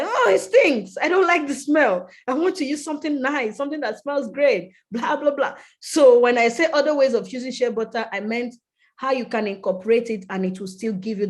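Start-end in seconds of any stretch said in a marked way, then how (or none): 0.0-5.6 high, louder mock whine about the smell; complaining. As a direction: whine like a fussy customer. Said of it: "I'm like".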